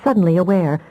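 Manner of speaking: ordinary delivery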